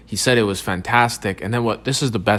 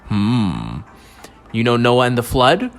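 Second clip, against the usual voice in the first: mystery documentary narrator; 2 semitones higher, 4 dB louder than usual. Acted. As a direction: tease the audience like a late-night mystery show host.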